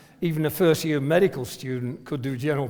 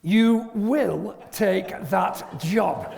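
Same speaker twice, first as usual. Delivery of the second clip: slow, louder, every word laid down; firm command; 3 semitones higher, 4 dB louder, much slower than usual. Retold or imitated. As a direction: mimic the wife's no-argument order. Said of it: "she said".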